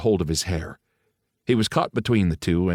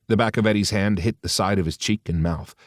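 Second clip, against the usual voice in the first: usual speaking voice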